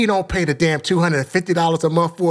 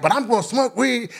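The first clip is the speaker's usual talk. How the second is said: higher-pitched retold challenge to the room; defiant; 7 semitones higher than usual. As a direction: challenge the whole meeting, pitched up. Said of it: "just be defiant to everybody".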